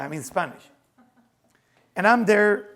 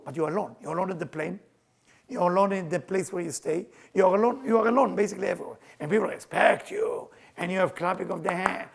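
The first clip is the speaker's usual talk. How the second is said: ordinary talk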